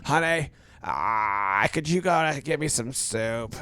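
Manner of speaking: sick husband begs his wife for soup, low and slow; pitiful sick husband. Retold or imitated. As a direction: stretch "Honey, ah" like you can barely talk. Sick man begging wife for soup.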